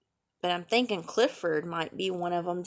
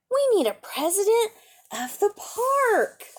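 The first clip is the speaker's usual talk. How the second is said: put-on high child's voice; girl's announcement; 10 semitones higher than usual. A narrator's reading. Girl tells her dog they need a park president, high and eager.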